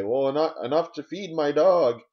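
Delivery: high, loud old-timer impression; hammed-up elderly voice, stretched at the end; old person. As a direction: proudly holler it like an elderly dog owner. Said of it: "And they'll say".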